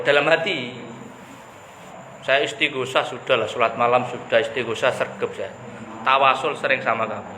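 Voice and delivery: ordinary delivery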